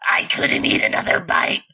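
menacing. Shown as a demonstration. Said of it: "everything with that voice sounds menacing".

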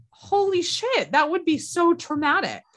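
stunned outburst as it sinks in; shocked exclamation. Real, not acted. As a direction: gasp it out in disbelief. Imagining carrying a dead fetus.

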